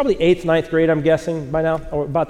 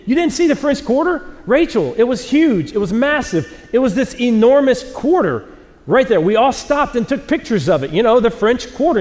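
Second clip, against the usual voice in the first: higher, animated, overselling a tall tale; mock insistence; 7 semitones higher than usual. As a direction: insist with fake conviction, pitched up. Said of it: "and I'm like".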